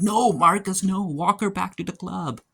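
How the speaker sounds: high, loud pleading with the character; urgent plea. Real, not acted. Viewer shouting at Marcus on screen, loud urgent plea. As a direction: beg him to do the right thing.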